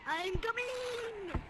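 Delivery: high, very slow drawn-out call. Real, not acted. Calling to a friend while falling, high and drawn out. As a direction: stretch it out high as you drop.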